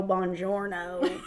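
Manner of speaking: put-on slow, drawled Southern-accent "Buongiorno"; mock a Southern actor's clumsy Italian "Buongiorno"; bad Southern Italian. Friend mocking an actor, slow Southern-accent Italian. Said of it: "comically bad Southern accent".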